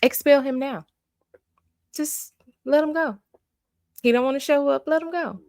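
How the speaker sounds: high, exasperated dismissal; exasperated venting. Real, not acted. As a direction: cut him loose, fed up. Fed-up viewer venting about student.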